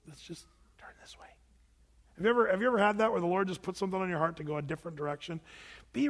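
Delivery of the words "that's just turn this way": whisper. Real, not acted. Whispered aside before sermon.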